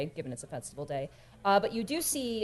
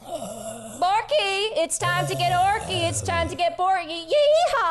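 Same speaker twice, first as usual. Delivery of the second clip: high, loud cowgirl wake-up call; gleeful chant rising to a yeehaw; rousing holler; 12 semitones higher, 7 dB louder, slower than usual. Acted. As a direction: whoop it up through a closed door. Skinny wakes Borky through door.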